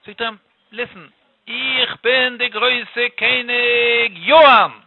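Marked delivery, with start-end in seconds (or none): 1.5-4.7 very slow, drawn-out royal proclamation; grand king. Acted. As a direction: announce yourself grandly, stretching every word. King grandly announces himself to stranger.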